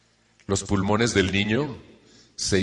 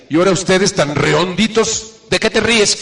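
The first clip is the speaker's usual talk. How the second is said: much higher, louder mocking father voice; a desperate dad snapping at laughing kids; exasperated dad imitation; 9 semitones higher, 5 dB louder than usual. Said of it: "mi papá se desesperaba y decía". (papá desesperado, imitado con voz mucho más aguda y fuerte)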